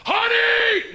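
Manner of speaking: loud, high holler, held long; screamed out loud. Retold or imitated. Husband screaming to wife in the produce section, real loud. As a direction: yell it across the whole store. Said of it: "I scream out li-, real loud".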